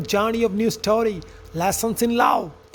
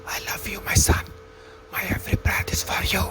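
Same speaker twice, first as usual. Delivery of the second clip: hushed, whispered fatherly confession; tender whisper; 3 semitones lower, 5 dB quieter than usual. Acted. Farmer finally telling his son he loves him, tender whisper.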